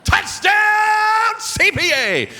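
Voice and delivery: high, loud, ringing PA call of an interception; stadium announcer call. Retold or imitated. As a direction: belt it out like the stadium announcer over the PA. Calling a game over the PA.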